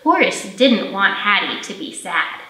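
ordinary delivery

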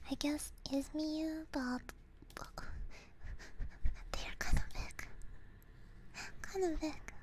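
ordinary talk, nothing marked

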